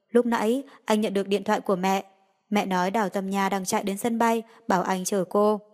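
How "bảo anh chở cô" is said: ordinary delivery, nothing marked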